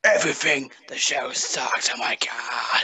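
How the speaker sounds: slow, theatrical delivery sinking to a whisper; Gary Oldman. Acted. Caller answering how to improve the network, hammed-up actor voice. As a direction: draw out the complaint, fading into a breathy whisper. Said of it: "Gary Oldman there".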